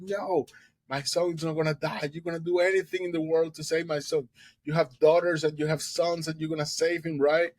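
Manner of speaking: high, fast insistent plea; desperate mother. Retold or imitated. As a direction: push the doctor hard to promise.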